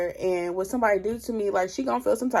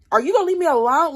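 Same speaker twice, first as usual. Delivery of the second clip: exasperated yell; 7 semitones higher, 7 dB louder, much faster than usual. Retold or imitated. Retelling Tony's angry phone call.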